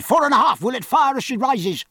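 high, loud shout across the guns; calling out. A narrator's reading. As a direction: shout it over the din. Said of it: "calling to the captain".

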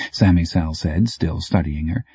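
usual speaking voice

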